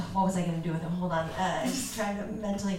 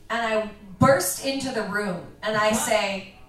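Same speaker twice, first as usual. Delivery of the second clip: ordinary delivery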